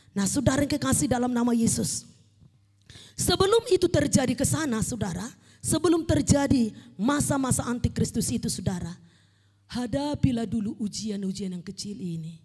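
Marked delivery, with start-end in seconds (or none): none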